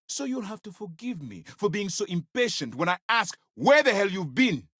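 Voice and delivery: high-pitched, exasperated dressing-down; angry impatience. Acted. Commander demands where subordinate has been.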